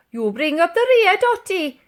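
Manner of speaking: put-on high, loud old soldier command; old soldier ordering the lady. A narrator's reading. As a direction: bark the order at her. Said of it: "ordered the old soldier".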